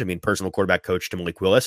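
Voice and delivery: ordinary delivery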